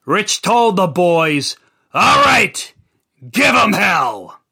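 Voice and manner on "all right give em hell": loud, high command to his men; shouted order. Acted. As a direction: bark it out to the boys. Boss orders the beating.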